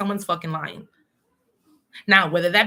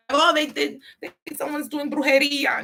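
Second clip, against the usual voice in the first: higher mock whine; 7 semitones higher than usual. Mocking someone blaming witchcraft.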